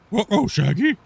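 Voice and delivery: goofy put-on exclamation of confusion; mock cartoon bafflement. Streamer acting out puzzled fans, mock cartoon bafflement.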